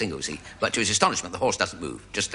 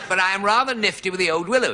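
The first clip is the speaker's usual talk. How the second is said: posh horse; 8 semitones higher, faster than usual. Acted. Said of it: "a bit posh, actually, this horse".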